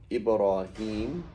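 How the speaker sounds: "Ibrahim" very slow, ending in long -heem; drawn-out -heem version. Shown as a demonstration. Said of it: "they have different versions".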